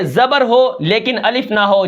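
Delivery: normal talking voice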